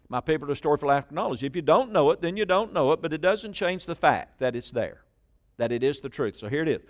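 ordinary talk

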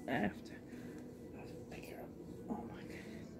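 hushed breathy whisper, barely any voice. Real, not acted. Vlogger whispering over her new doll, hushed whisper.

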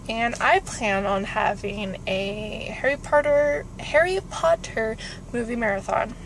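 ordinary talk

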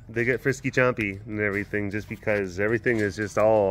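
ordinary talk

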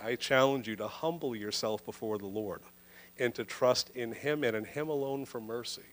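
ordinary talk

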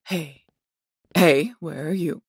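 loud call after an escaping captive. Acted. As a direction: call out sharply for someone who slipped away. Captor calls after slipping captive.

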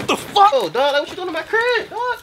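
loud, high-pitched alarm; startled outburst. Acted. Man finding friend in his crib, startled loud protest. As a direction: yelp in shock at the intruder.

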